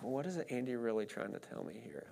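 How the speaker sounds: a hushed private question in your head; inner wondering. Retold or imitated. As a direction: muse it quietly to yourself. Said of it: "I'm like".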